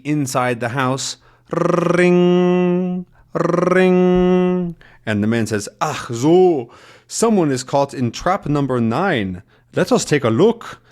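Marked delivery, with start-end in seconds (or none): 1.5-4.8 high, sustained trilled ring; imitated ringing bell. Imitating phone or alarm bell. 5.8-10.8 hammed-up German villain accent; terrible German accent. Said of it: "That's my terrible German accent".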